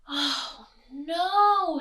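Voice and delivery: loud, high, drawn-out wail; Mister Bear at his wits' end; exasperated bear. A narrator's reading. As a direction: groan it in storybook despair. Bear kept awake by clock.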